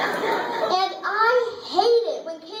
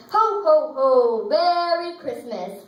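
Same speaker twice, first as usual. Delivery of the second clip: Captain Jelly Belly; 3 semitones lower, slower than usual. Acted. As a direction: boom it out like Santa, drawing out the greeting. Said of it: "Captain Jelly Belly looks at us and says".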